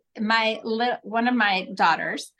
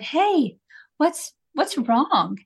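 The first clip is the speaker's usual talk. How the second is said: gentle concerned ask; 5 semitones higher, much slower than usual. Retold or imitated. Mother kneeling to her screaming daughter, slow gentle ask. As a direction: ease it out slowly to a crying toddler. Mom kneels to upset child.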